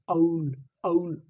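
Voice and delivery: औल drawn out slow, a blending into u; drawn-out aul. Shown as a demonstration. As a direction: stretch औल slowly, a sliding toward u. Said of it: "अ के साथ ऊ की आवाज़ आ रही है".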